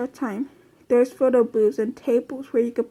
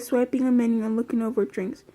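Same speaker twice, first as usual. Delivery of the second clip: ordinary delivery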